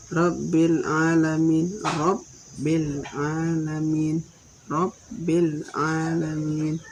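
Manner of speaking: Rabbil with the b held doubled and stressed. Shown as a demonstration. Teacher showing students the doubled b, slow careful Rabbil. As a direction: lean on the double b of Rabbil, slowly. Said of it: "kita harus baca bertasdid".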